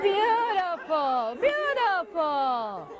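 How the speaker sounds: slow, drawn-out praise; slow admiring beautiful; warm praise. Real, not acted. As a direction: savour it, praising the room. Praising the audience's performance.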